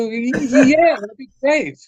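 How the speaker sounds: high, stammered yes; retold starstruck stutter; flustered eagerness. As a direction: stumble into an eager acceptance. Stammering acceptance of recording invitation.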